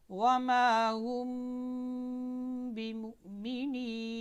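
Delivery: "वमा हुम बिमुमिनीन" recited slowly, meem of "हुम" held as a hum; hidden meem. Shown as a demonstration. Teacher shows how to hide meem, "हुम" hummed long into "बिमुमिनीन". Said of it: "छुपा कर पढ़ना है। कैसे देखें".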